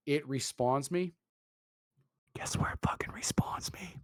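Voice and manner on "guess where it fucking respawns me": whispered setup for the punchline; incredulous whisper. Real, not acted. Gamer telling co-host where he respawned, incredulous whisper. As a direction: whisper it like an outrageous secret. Storyteller building to reveal.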